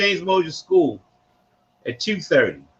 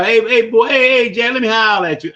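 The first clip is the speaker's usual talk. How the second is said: put-on hey-boy Baltimore shout-out, louder; Baltimore greeting, called out; 3 semitones higher, 4 dB louder than usual. Shown as a demonstration. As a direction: call across the block in Baltimore talk. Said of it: "That's how".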